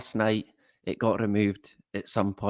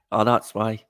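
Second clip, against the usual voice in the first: normal talking voice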